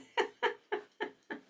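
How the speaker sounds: high, airy giggle; amused giggle. Real, not acted. Host laughing at forgetting a name, high giggle. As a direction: giggle helplessly in a light, high run. Laughs at own memory lapse.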